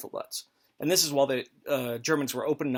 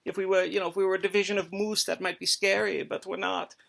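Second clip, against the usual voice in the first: higher, mock-smug soldier joking with buddies; German soldier mocking Canadians, higher and lightly smug; 5 semitones higher than usual. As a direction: sneer at the Canadians lightly, pitched up. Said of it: "joking with your buddies that it's like".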